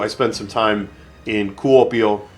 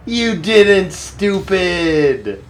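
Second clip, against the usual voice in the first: very slow, stretched, high and delighted; slow sing-song gloat of joy; drawn-out cheer; 8 semitones higher, much slower than usual. Real, not acted. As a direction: savour every word, dragging it out.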